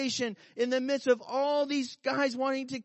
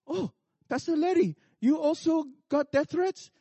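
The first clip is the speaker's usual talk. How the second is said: surprised Korean pastor; 5 semitones higher than usual. Retold or imitated. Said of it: "the pastor says".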